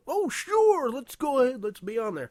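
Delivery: high, loud sing-song of a too-eager guest; mock eager guest. Mocking how guests supposedly agree on the spot, high chirpy voice. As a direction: lampoon the easy yes in a high chirpy voice.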